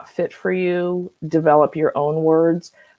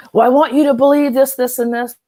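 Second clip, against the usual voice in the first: higher, louder, fast mocking whine; mock demanding voice; 8 semitones higher, 4 dB louder, faster than usual. Mocking a ranter who demands agreement, in a whiny voice. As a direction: parody the person who won't let go. Mimicking a stubborn, demanding person.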